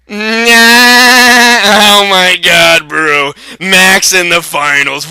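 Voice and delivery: loud, dragged-out shout of disbelief; amazed shout. Real, not acted. Co-host reacting to Max reaching the finals, loud and amazed. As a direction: stretch it out in loud astonishment.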